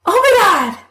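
high, thrilled exclamation of surprise; excited gasp. A narrator's reading. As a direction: squeal it out in delight. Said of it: "in her excitement".

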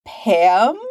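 eager, questioning pounce on the name; eager pouncing. A narrator's reading. Nerds who scent gossip, eagerly pouncing. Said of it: "like they've scented blood".

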